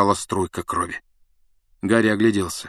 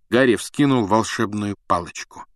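plain reading voice

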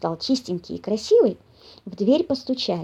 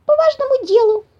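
very high, louder squeak; impatient little mouse; 13 semitones higher, 5 dB louder than usual. A narrator's reading. Climbing mouse butting in, impatient squeak. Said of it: "добавил, не утерпев".

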